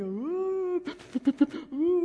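high, wailing fake sob; hammed-up crying "ooh"; mock sobbing. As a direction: pretend to sob like a pitiful creature. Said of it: "The devil is sobbing".

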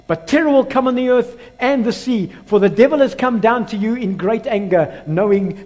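loud, high preacher's warning; ringing pulpit delivery; forceful scripture proclamation. Acted. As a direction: declaim the warning to the whole congregation. Declaims scripture at full force.

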